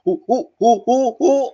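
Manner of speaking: string of short high involuntary hoots; quick rising "ooh" yelps; panicked throat whoops. Shown as a demonstration. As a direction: imitate the throat noise of a scary drop. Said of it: "your internal th-, throat is making that".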